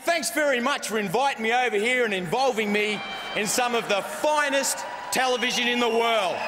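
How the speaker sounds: ordinary talk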